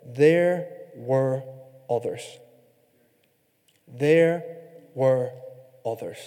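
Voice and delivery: THERE, WERE, OTHERS set apart by pauses, falling softly away; dramatic pauses. Real, not acted. Preacher dwells on the key verse, dramatic pauses.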